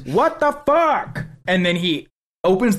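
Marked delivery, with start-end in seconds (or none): 0.0-1.3 imitated bewildered outburst. Host imitating the man before he opens the door, high drawn-out outburst. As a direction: draw it out high, imitating his disbelief. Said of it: "he goes".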